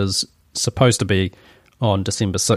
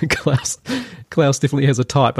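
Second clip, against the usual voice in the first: laughing; 3 semitones higher than usual. Real, not acted. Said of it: "I shouldn't say taste".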